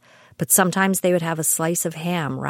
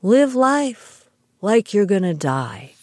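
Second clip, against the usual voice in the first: mock the actor's halting, grand delivery; a hint of a halting, dramatic star quoting a line; William Shatner; 4 dB louder than usual. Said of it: "to quote William Shatner".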